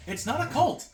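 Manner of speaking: high, loud mock outburst; sudden delighted realization. Player voicing Aggie once exposed to the artifact, excitedly high. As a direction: burst out high, catching yourself mid-thought.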